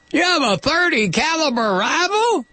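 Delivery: mock his little rifle from way up high; scoffing local. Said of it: "I have to do this occasionally".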